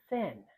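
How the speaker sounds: thin with a crisp unvoiced th; exaggerated th practice. Shown as a demonstration. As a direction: blow air through the th in thin. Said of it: "Listen again as I say".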